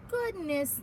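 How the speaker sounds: ordinary delivery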